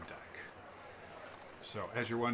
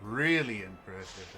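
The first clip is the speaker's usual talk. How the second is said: elf shopkeeper. Acted. Elf offers customer another wand, theatrical reassurance. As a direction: lay on the sales pitch. Game master voices wand seller.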